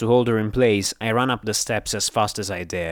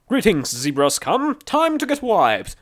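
high, drawn-out robotic cheer; gusto-filled metallic robot; 11 semitones higher, 3 dB louder than usual. A narrator's reading. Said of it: "A metallic voice cheered with gusto".

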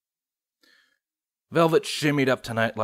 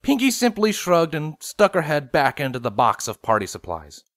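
usual speaking voice

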